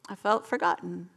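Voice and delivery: ordinary talk, nothing marked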